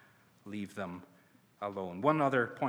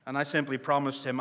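normal talking voice

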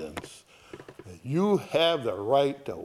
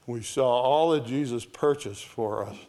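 normal talking voice

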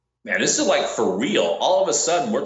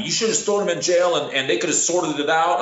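higher, mildly irritated scolding; mild mock anger; 6 semitones higher than usual. Bounty hunter imitates the angry bondsman, mildly scolding. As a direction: voice the bondsman's complaint, a bit higher. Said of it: "The bondsman's mad at me".

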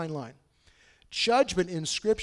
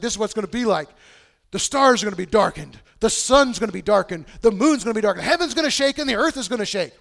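prophet proclaiming; 4 semitones higher, 7 dB louder than usual. Acted. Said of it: "the prophet's like".

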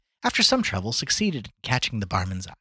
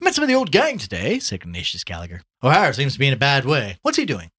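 loud, pitched-up chatty voice; breezy Gallagher, gossiping; 6 semitones higher, 7 dB louder than usual. Acted. Gallagher asks after the old gang, breezy. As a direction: pass on pub gossip heartily.

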